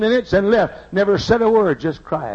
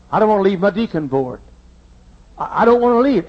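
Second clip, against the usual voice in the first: ordinary talk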